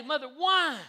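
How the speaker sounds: put-on loud, high kid's protest; protesting child. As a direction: wail it at mother like a thwarted child. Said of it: "And I'd say".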